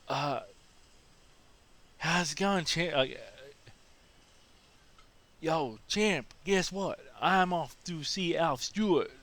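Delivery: high, overfriendly American dude voice; high, pally "yo champ" tourist; chummy tourist. Retold or imitated. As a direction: greet him like an old buddy, eager. Same tourist greets at bus stop.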